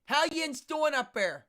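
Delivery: loud, high, drawn-out Pittsburgh accent; hammed-up Pittsburgh greeting, pitched high. Shown as a demonstration. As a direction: call out like a Pittsburgh Yinzer. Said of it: "in Pittsburgh, it's Yins".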